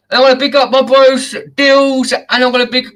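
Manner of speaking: high, loud host shout-outs; big up the crew with energy; hyped shout-out. Real, not acted.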